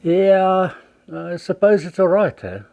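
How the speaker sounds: grudging reply. Acted. Friend answering a greeting, loud and grudging. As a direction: shrug it off as if things are meh.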